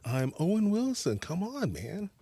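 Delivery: Owen Wilson impression. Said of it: "Wait, do that again".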